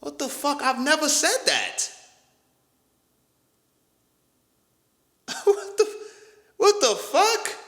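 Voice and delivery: high-pitched, disbelieving reaction; incredulous. Real, not acted. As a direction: squeak it out in total disbelief. Disbelief at being misquoted.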